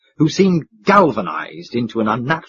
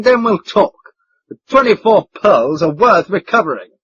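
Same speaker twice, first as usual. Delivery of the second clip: commanding; 7 semitones higher, 4 dB louder than usual. Acted. Colonel giving orders.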